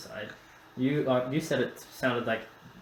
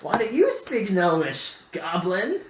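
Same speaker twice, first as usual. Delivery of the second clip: higher, louder sneering voice; disgusted gnome; 7 semitones higher, 5 dB louder, slower than usual. Acted. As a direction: spit it out in disgust. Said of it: "His face is disgusted".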